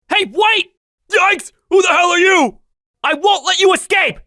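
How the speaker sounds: high, loud yells while giving chase; loud panicked chase cries; frantic shouting. Acted. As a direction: shout after him as he runs. Chasing the fleeing paparazzi.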